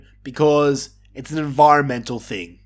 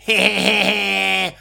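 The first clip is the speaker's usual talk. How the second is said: mocking imitation of a smug little laugh; imitated smug laugh; 3 semitones higher than usual. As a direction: cackle like the annoying wrestler. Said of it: "doing his little laugh".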